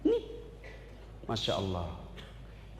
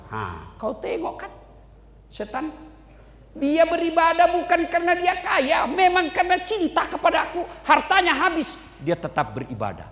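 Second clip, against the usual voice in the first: voicing God; 6 semitones higher than usual.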